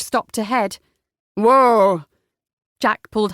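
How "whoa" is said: loud call to pull up the horses; driver's loud command to the horses. A narrator's reading. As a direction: haul the team in with a loud cry. Driver pulls horses to stop.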